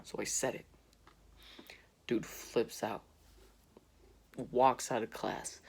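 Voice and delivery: usual speaking voice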